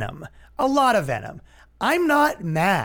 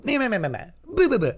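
higher-pitched nonsense jeering; mocking babble; 6 semitones higher, faster than usual. Comic imitating critics shouting him down, mocking babble. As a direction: reduce the critics to silly noises.

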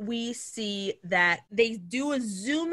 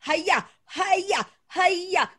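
high-pitched mock martial-arts yelps; imitated fight cries; 6 semitones higher, much slower than usual. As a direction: punch out each cry like a cartoon fighter. Imitating the whip-wielding fighter's cries.

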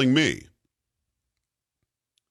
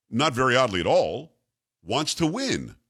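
ordinary delivery

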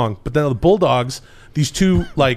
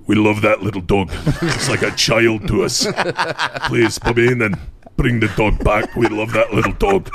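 lower, flat repeat of the plea; Dynamite Kid deadpan; 3 semitones lower than usual. Retold or imitated. Lightly voiced wrestler repeating his partner's plea, low. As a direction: mumble the same plea lower. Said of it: "all he says is".

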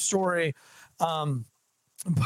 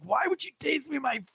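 mock outrage; 9 semitones higher than usual. Retold woman's angry question.

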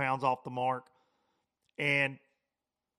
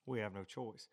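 hushed heavy repeat; 4 semitones lower, 13 dB quieter than usual. Retold or imitated. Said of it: "and Brad's like".

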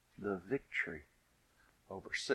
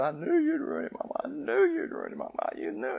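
put-on much higher accusing child wail; little girl's fit. Scene: little girl sees the torn-up shoe and wails at mama, much higher. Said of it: "had a fit".

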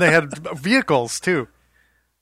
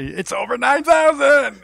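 slow, higher and louder put-on villain voice; dub villain voice; 9 semitones higher, 6 dB louder, slower than usual. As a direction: stretch it out like an overacted cartoon villain. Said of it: "everybody has to sound like this".

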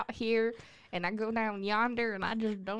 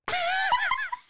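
shrieking laugh; 6 dB louder than usual. Real, not acted. Bursting at a mispronunciation.

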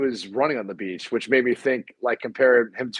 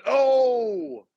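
hype announcer call; 11 semitones higher than usual. Acted. Celebrating dunk like announcer.

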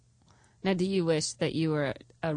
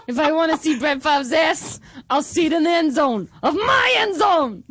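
put-on higher, louder Boston accent shouted with laughter; 7 semitones higher, 5 dB louder than usual. Patriots fan mocking Brett Favre, loud laughing Boston accent shout. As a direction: yell it in a hammed-up Boston accent. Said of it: "Just do that accent?".

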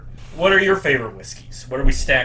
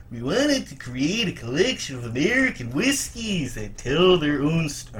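[name]; 3 semitones higher than usual. Retold or imitated. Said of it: "Everyone does a good Dylan impression".

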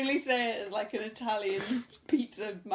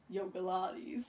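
aging surfer dad; 5 semitones lower, 9 dB quieter, slower than usual. Shown as a demonstration. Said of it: "Say it like a, an aging surfer dad".

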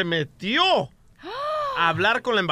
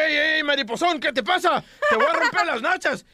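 raised-pitch mock confrontation; comic macho threat, pitched up; truck driver threatening; 7 semitones higher than usual. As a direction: challenge him like an irate driver. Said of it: "se baja el vato de la camioneta".

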